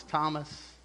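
soft, low, tender call on the name; gracious, merciful. Retold or imitated. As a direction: say the name softly, with mercy. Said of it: "in a gracious, merciful way".

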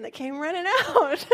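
laughing as the line breaks up; laughing through the words. Real, not acted. Mother laughing as she retells her daughter's words.

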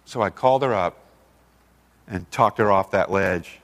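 usual speaking voice

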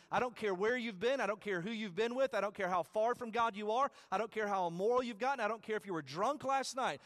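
fervent preacher calling out to the congregation; preaching at full force. Acted.

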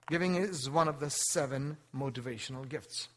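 usual speaking voice

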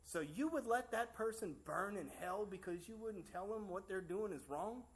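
slow, pointed mock-accusing question; hint of a judgmental friend, drawn out; slowly quoting friend. Friend at work quizzing the preacher, slow and pointed. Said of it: "And he says".

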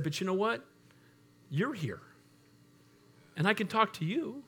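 soft, hushed, choked-up retelling; hushed retold words of a weeping young man; retold tearful nephew. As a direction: quietly voice the grieving nephew. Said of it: "he starts weeping, and he's shuddering".

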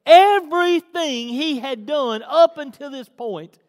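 loud, high-pitched sermon delivery; forceful preaching. Acted. Preacher recounting God's miracles to the congregation, forceful preaching. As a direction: preach it out with weight on the big words.

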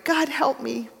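the ghost whimpering to God, still voiced. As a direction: whimper the prayer aloud. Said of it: "but ended whimpering".

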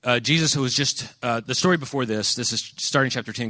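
ordinary talk

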